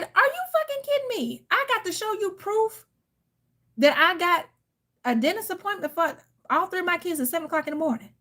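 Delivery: very high, incredulous retelling; a high, indignant quote; retold outrage. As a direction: replay your disbelief at him. Retold angry reply to ex.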